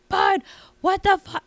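mock her screeching at Bud; shrill drunk wife. Bartender imitating the drunk wife yelling at her husband. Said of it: "She was like".

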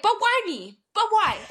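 high, loud, baffled retold plea; acted-out incredulous pleading; pleading protest. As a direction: question it in disbelief, voice jumping up. Acts out protesting to God.

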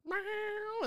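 high, soft meow; the cat visits in the trip with a high meow. Retold or imitated. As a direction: mew like a gentle cat. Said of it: "It was more of, like".

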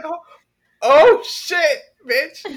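loud, high burst of mock alarm; mock panic. Medicated neighbor seeing police outside, loud mock panic. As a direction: blurt it out in comic panic.

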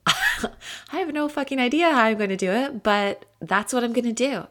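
giggling at her own boldness; laughing through the words. Real, not acted. Laughing admission of no plan.

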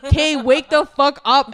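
loud, slow furious yell; screaming at a friend to wake up; unhinged shout. Retold or imitated. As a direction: yell it to snap her awake. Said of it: "I'm unhinged".

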